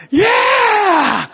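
high excited whoop; mock baby cheer. Baby hearing music.

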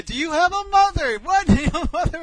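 high, loud outraged yell; acting out a furious wife yelling. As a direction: holler the challenge as you're being pulled away. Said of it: "she's yelling at this guy".

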